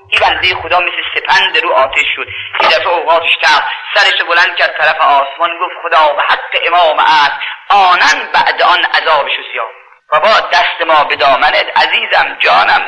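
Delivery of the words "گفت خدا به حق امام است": ordinary talk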